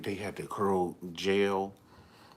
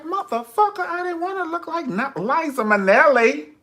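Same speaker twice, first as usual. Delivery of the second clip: loud high indignant; 9 dB louder than usual. Retold or imitated. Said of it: "I said".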